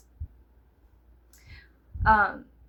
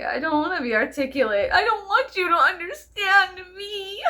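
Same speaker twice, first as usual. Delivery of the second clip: whiny; 8 semitones higher, 5 dB louder than usual. Pretend. Mock whiny refusal.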